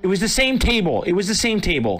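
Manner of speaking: high-pitched, pleading insistence; insistent protest. Real, not acted. Gambler insisting to friends about the table, insistent protest. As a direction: protest it high, twice over.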